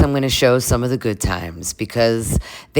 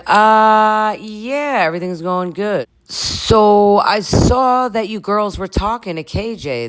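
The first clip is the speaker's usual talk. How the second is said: high, drawn-out uneasy imitation; imitating stressed Dara; 8 semitones higher, slower than usual. Dara probing Mia about the girls' chat, mock stressed. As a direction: stall on a high note, then fish for information. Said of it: "Dara looking pretty stressed out".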